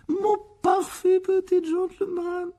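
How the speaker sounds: very high, slow doting exclamation; overcome with emotion. A narrator's reading. Aunt admiring her perfect little boy, gushing with emotion. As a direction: gush over him as if near tears. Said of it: "s'exclama la tante Pétunia avec émotion".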